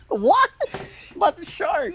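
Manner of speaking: high-pitched laugh breaking into the words. Real, not acted. Co-host suggesting the shark gimmick to his friend, laughing. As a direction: squeal the question through laughter.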